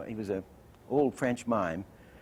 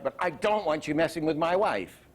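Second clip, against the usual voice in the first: DON'T punched, higher-pitched warning; hard accent; 5 semitones higher, 4 dB louder than usual. Shown as a demonstration. Teacher acting out a warning to a rival, hard emphatic accent. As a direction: hit "don't want you messing" hard. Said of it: "two soft accents and a hard one".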